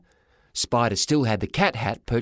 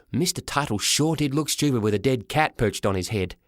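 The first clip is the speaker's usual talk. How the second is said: ordinary delivery, nothing marked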